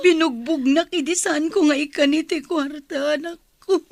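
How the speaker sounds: tearful telling. Acted. Mother tells of beating.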